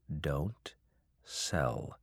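whisper. Acted. Secret advice to the farmer.

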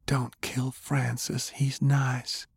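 put-on high, soft and slow child's plea; pleading little girl. A narrator's reading. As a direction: beg gently like a small child.

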